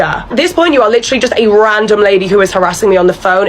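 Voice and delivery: ordinary talk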